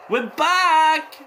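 high, giddy cheering between laughs; elated laughing shout. Real, not acted. Fan still celebrating the goal.

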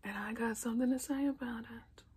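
hushed secretive whisper. Real, not acted. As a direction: lean in and whisper it to the viewers. Conspiratorial aside to viewers.

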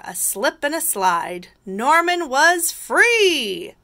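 lilting, triumphant read-aloud; picture-book narration. A narrator's reading. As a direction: relish each key word for children.

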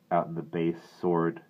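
plain reading voice

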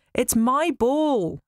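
put-on high, slow, whining child; sulky whine. A narrator's reading. Athene sulking again.

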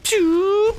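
high squeaky pew, like a toy gun firing; playful mouth gunshot; mouth pew sound. Pretend. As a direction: blurt a high pew for the shot. Imitating sniper shot.

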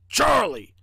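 small, gruff storybook voice. A narrator's reading. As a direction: announce it in a small, rough voice. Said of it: "said the small, gruff voice".

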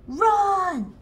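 high, loud shout of run; yelling. A narrator's reading. Dad yelling at his kid to run from bees, high loud shout. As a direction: yell it high to warn of bees. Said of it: "Dad yells".

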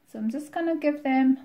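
usual speaking voice

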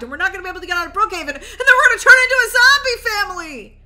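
fast, climbing to a high scream; rising panic. Acted. Dad warning family of clogged roads, panicked scream. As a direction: build from rushed worry to shrieked panic.

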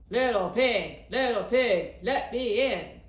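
loud, booming wolf voice; big bad wolf. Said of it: "The wolf went to the third little pig's house".

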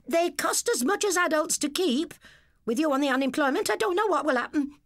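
high, fast shrieking wife; angry rant. A narrator's reading. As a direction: tear into the husband. Dora rants at husband.

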